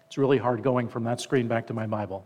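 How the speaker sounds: ordinary talk, nothing marked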